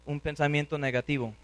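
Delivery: ordinary delivery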